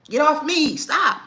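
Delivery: high loud yell; sharp yell. Shown as a demonstration. Showing moms how to yell at the kid. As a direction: snap it at the kid. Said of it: "demo telling kid off".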